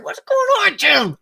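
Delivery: playful imitation of a friend saying hi; channeling Tim. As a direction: pitch it way up like a buddy's voice. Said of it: "I was trying to channel you".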